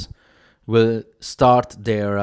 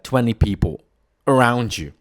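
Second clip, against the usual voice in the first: ordinary delivery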